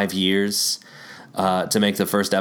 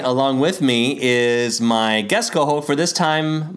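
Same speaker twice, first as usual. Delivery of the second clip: usual speaking voice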